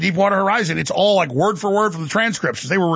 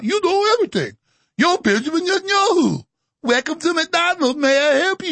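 hammed-up mock simpleton, pitched way up; Benjamin Netanyahu; 6 semitones higher than usual. Mocking a leader promising regime change, dopey. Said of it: "And that's Netanyahu".

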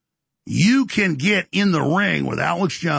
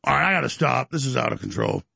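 normal talking voice

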